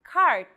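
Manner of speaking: Cart with a hard English K; K read as in English. Shown as a demonstration. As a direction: clear K sound. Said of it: "the letter K is read just like in English".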